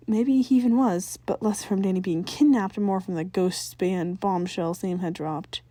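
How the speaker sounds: ordinary delivery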